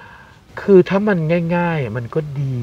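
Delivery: slow explaining. Retold or imitated. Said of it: "ก็บอกว่า".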